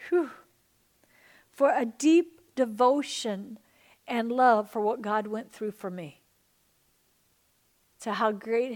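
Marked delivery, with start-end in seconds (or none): none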